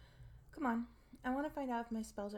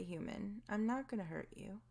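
normal talking voice

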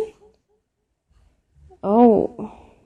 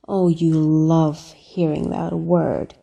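ordinary delivery

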